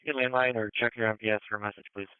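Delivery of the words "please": ordinary talk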